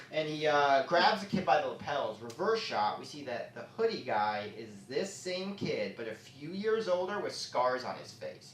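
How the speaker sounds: ordinary talk, nothing marked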